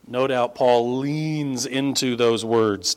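ordinary delivery

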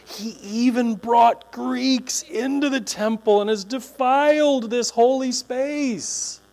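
slow, weighty accusation; drawn-out outraged charge; solemn charge. Acted. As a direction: read it like a grave indictment. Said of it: "the accusation".

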